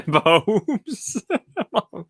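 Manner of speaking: amused, breaking into a real chuckle; laughing. Real, not acted. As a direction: laugh through the word. Amused by people planting hundreds.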